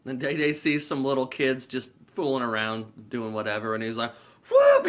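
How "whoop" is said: very high, loud whoop; whistle blast imitation. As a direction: mimic the whistle with a sudden hoot. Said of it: "He blows the whistle again".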